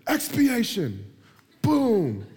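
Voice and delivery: high, drawn-out shout of a big word; mock prayer yelling. Preacher mocking wordy prayers, yelling. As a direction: yell it like a showy pray-er. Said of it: "You're just yelling the biggest words".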